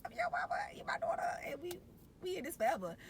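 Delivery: put-on very high, tender baby talk, partly whispered; tender to newborn. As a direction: coo to the new daughter. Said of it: "I was just like".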